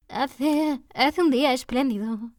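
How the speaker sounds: timid little voice. A narrator's reading. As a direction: murmur it shyly. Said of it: "una tímida vocecilla".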